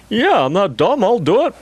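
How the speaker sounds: high-pitched, swooping mock eagerness; mock eager reply. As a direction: play a gullible yes-man, voice way up. Acts out his eager reply.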